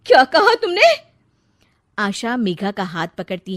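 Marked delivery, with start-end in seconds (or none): 0.1-0.8 sharp, high, loud outburst; stung mother-in-law shouting at the bride; furious. Acted. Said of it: "तिलमिला उठती है".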